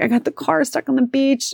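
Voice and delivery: high, sheepish-sounding admission; retold confession. Wife explaining the stuck car to husband, high-pitched confession.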